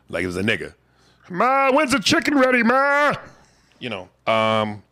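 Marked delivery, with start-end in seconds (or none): 1.3-3.1 high, loud whiny Ray Romano call. Retold or imitated. Comedian doing Ray Romano calling his mother, high and loud.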